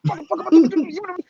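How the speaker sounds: high-pitched comic fit of anger; mock frantic cursing; getting all mad. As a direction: work yourself up in a high voice. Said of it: "you getting all mad and shit".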